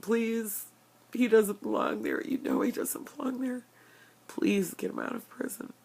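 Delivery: high, half-whispered sobbing plea; begging through real tears, voice breaking; tearful pleading. Real, not acted. Praying to the ancestors to free George, sobbing. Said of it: "this is really hard".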